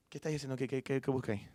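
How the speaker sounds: a hint of Maracucho accent, quiet. Jesus asking Mary, lightly in Maracucho accent. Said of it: "Así en maracucho".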